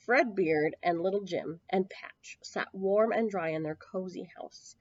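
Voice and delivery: ordinary delivery, nothing marked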